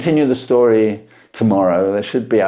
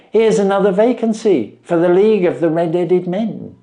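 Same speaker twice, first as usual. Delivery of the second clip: a hint of a keen young clerk, pitched higher; excited assistant tipping off his employer, pitched higher; lightly voiced eager assistant; 6 semitones higher than usual. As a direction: play the assistant bursting with news. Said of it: "says he".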